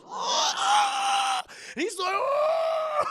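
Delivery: very high, loud oh; shrieks. Retold or imitated. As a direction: shriek in shock. Girl leaps on the priest.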